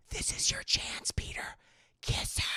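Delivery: conspiratorial whisper. Retold or imitated. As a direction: hiss the nudge in his ear. Karen urging Peter secretly.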